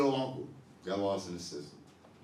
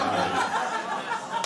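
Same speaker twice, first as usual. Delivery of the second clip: laughing; 8 dB louder than usual. Retold or imitated. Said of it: "I was like".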